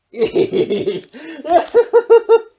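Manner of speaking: loud high helpless giggling; ticklish giggle. A narrator's reading. Smiley Shark tickled by Octopus, loud giggles.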